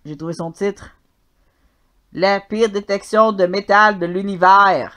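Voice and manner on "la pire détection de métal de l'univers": announcer title read. Acted.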